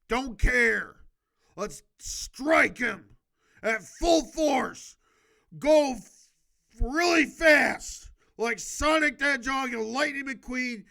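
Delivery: building from loud to high and very loud; pumped-up battle cry. Acted. Reader hyping viewers for an all-out attack, building excitement. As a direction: rev up the troops for a full-force charge.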